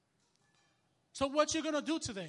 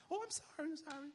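high, soft, meek repeated sorry; wimpy apology; 5 semitones higher, 8 dB quieter than usual. Retold or imitated. Preacher hamming up his timid apology to the couple. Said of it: "got all wimpy".

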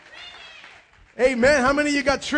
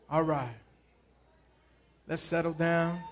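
ordinary talk

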